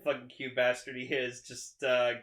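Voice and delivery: usual speaking voice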